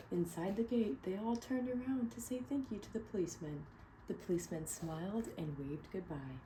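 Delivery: clear, expressive read-aloud narration; storybook reading. Acted. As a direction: read it to a room of kids. Reading picture book aloud.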